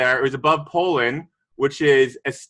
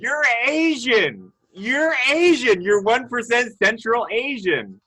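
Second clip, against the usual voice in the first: surprised announcement; 7 semitones higher than usual. Real, not acted. Reads surprising one percent result.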